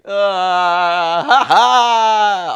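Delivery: loud high whoop of a cheering crowd; mock crowd cheer. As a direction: whoop it like the whole city at once. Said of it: "went like".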